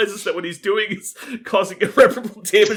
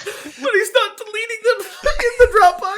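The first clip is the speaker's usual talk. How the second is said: laughing; 4 dB louder than usual. Real, not acted. Telling friends the story, laughing helplessly. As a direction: laugh the words out, much higher.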